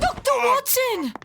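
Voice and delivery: high, loud shout of a name in alarm; alarmed call. Acted. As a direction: call for help urgently. Calling out in alarm.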